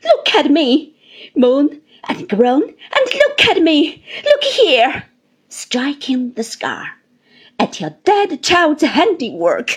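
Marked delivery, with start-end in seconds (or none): 0.0-5.0 loud, high, bitter demand; impassioned outburst rising at the end; passionate cry. A narrator's reading. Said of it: "smiting herself passionately". 7.6-9.7 loud, raised bitter accusation; impassioned, scornful outburst; passionate cry. A narrator's reading. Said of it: "cried Rosa".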